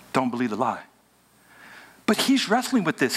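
ordinary talk, nothing marked

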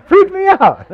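high loud laughing exclamation. Real, not acted. Preacher laughing about the demon lady, loud and high. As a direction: laugh it out, still shaken.

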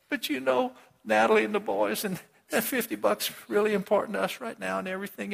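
high, pleading begging voice; high mock-desperate begging servant.

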